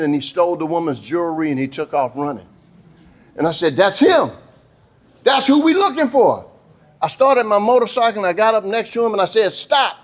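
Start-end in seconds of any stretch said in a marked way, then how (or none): 3.8-6.4 high-pitched, urgent cry of discovery; raised-pitch realization; excited recognition. Acted. Spotting the thief in story. 9.5-10.0 officer's order. Acted. Officer orders fleeing boy.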